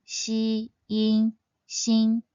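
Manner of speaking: "xi", "yin" joined into "xin"; spelling out syllable. Shown as a demonstration. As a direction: put "xin" together piece by piece. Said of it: "Its pinyin is spelled".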